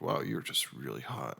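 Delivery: low, soft, slow awe; hushed, slowed-down admiration; smitten. Retold or imitated. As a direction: drop your voice, suddenly very taken. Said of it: "I was like, all of a sudden".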